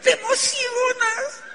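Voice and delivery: very high, slow, tearful exclamation; excited cry. Acted. Telling recruiters how sponsoring feels, a high tearful cry. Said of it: "¿te emocionas o no te emocionas?".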